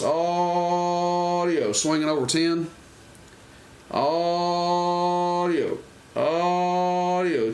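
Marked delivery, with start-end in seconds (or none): 0.0-1.7 long, high, stretched "Audio" into the mic; radio check, drawn out and high; drawn-out mic call. Shown as a demonstration. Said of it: "audio into the mic too loud". 3.9-7.5 long, high, stretched "Audio" into the mic; radio check, drawn out and high; drawn-out mic call. Shown as a demonstration. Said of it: "Talking to it normal".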